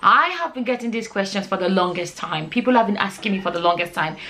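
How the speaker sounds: ordinary talk, nothing marked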